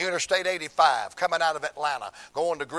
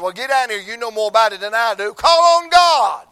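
urgent shout; 7 semitones higher, 13 dB louder than usual. Retold or imitated. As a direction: push him to his knees, building to a cry. Urging son to pray.

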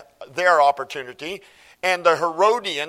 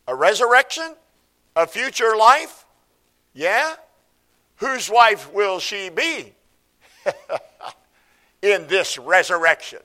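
higher, louder scornful mock questions; 3 semitones higher, 4 dB louder than usual. Preacher mimicking Sadducees taunting Jesus, mocking. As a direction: ham up their smug challenge.

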